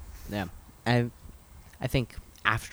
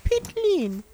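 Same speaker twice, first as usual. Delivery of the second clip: much higher mock British accent; squeaky British accent; 4 dB louder than usual. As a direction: squeak it out like a posh Brit. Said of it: "Sound like Shakespeare".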